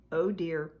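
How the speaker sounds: ordinary delivery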